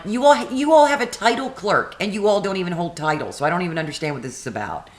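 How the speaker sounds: a frustrated customer losing patience; exasperated rant. Retold or imitated. Retelling her frustrated rant.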